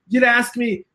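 ordinary delivery

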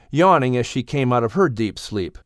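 usual speaking voice

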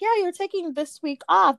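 boss's cheery okay. Retold or imitated. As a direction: say it like a happy yes. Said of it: "my boss was like".

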